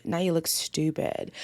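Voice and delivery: matter-of-fact verdict on STUPID, pitch dropped. Real, not acted. Same scolding repeated, dropped down.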